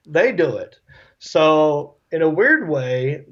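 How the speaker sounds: ordinary talk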